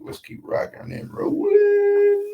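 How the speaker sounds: high, slow drawn-out sign-off; playful high drawn out send-off; sing-song sign-off. Real, not acted. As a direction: stretch it out high like a hype host. Sign-off catchphrase.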